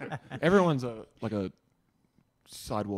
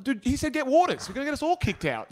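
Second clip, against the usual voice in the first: much higher and louder urgent hiss-free plea; panicked scolding; 9 dB louder than usual. Retold or imitated. Friend scolding his brother at the Melbourne pub, high and loud. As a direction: urge him frantically to behave. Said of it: "I'm like".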